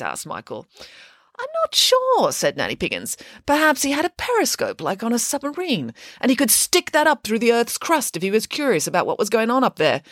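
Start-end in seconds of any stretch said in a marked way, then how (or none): 1.4-10.0 high, grand boast; boastful pig nanny. Acted. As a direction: crow about it proudly. Nanny speculates.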